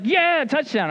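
high, loud touchdown cheer; imitated cheering. Friend watching the game thinks his team scored, mock celebration. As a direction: whoop it like an excited fan.

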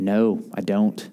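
very low, slow denial; baffled deadpan. Retold or imitated. Pastor not remembering his own illustration, slow low deadpan. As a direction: drop your voice and draw out the admission.

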